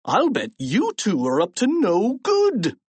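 a touch of the principal who caught them; suspicious principal. A narrator's reading. Principal accusing two boys of mischief, suspicious.

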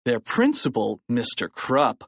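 usual speaking voice

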